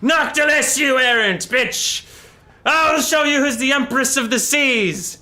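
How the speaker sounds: high, loud challenge to a rival; furious scream. Retold or imitated. Aranessa calls out Noctilis, loud and high furious. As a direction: bellow her rival's name in rage. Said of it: "she screams out".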